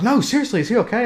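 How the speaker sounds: anxious insistence, voice up high; worried high voice. Retold or imitated.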